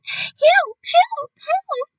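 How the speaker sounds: high, loud panicked screams for help; panicked yelling. Acted. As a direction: shriek like a teacher standing on a desk.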